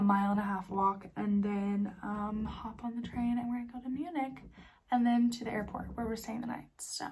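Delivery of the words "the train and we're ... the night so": whispered aside; hushed whisper trailing off. Real, not acted. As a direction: drop to a whisper as you finish. Whispering on a rainy walk.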